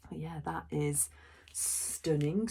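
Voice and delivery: ordinary talk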